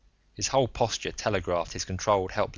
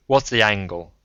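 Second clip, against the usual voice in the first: put-on louder, blunt old man's voice; a rough self-made man ribbing him; Braun, gruff old gambler; 7 dB louder than usual. Braun on the phone.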